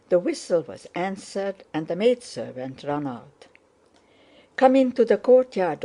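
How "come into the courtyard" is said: higher, louder call to come in; urgent call. A narrator's reading. Maid hurrying the lovers out of sight, urgent call. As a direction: beckon them inside with a raised voice.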